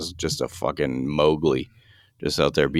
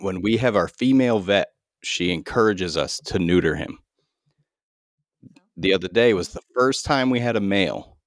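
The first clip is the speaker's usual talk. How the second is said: ordinary delivery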